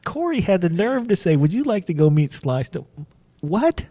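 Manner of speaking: high, comically indignant outburst; mock incredulity. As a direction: act floored by such a needless question. Mock disbelief at friend's casual question.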